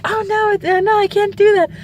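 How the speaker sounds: high, squeaky mom backing out; mom recoiling. Retold or imitated. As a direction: play mom giving up in disgust. Mom voiced recoiling from shrimp.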